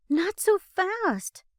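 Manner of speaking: slow, high, loud plea; pleading. A narrator's reading. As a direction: beg him to slow down. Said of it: "Becca pleads".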